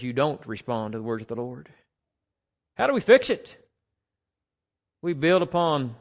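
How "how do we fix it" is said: high, loud rhetorical question to the church; emphatic question. Real, not acted. Preacher to congregation, asking high and loud. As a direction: ask it again, ringing out.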